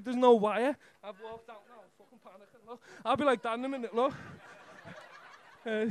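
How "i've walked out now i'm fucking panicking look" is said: much softer muttered aside; muttered nervous aside; quiet aside. Real, not acted. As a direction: confide it under your breath. Aside about nerves.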